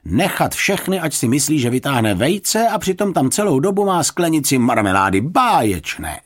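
high, loud, gleeful marvelling; delighted praise. A narrator's reading. Neighbour marvelling at the marmalade trick, high excited praise.

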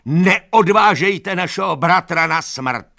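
high, loud, drawn-out appeal; shouted plea. A narrator's reading. Animals beg horses for brother's life, desperate shouting. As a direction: plead at the top of your voice. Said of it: "křičela".

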